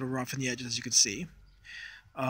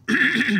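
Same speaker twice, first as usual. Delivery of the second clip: throat clearing; 11 semitones higher, 7 dB louder than usual. Real, not acted. Clears throat mid-intro.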